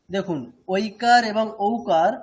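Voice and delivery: normal talking voice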